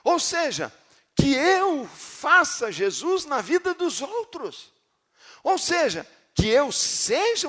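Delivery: loud, high preaching at full force; fiery preaching. Acted. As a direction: preach it out to the congregation, slow and loud.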